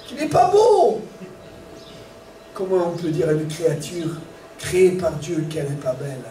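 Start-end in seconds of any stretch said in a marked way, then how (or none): 0.0-1.0 much higher, louder sneering insult; mocking insult. Preacher imitating someone insulting a person, high sneering mock. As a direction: sneer it like a gossip. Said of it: "Il dit".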